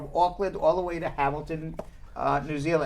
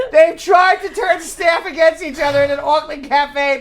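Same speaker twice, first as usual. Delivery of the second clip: loud, high alert-style announcement; mock alarm announcement; mock emergency alert; 6 semitones higher, 6 dB louder than usual. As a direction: read it like an urgent bulletin. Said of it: "said one of these alerts".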